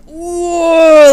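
loud high gasp of shock; a wincing loud whoa. Retold or imitated. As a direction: react like you just saw a bad injury. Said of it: "I literally went".

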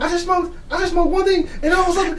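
very high, loud, half-crying mockery; shrill hammed-up panic, laughing; mock panicked stoner. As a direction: squeal it like a freaked-out friend. Friend mimics her panicked retelling.

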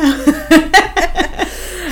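high burst of giggling; giggle fit. Real, not acted. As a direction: break into a giddy laugh. After swooning over actor.

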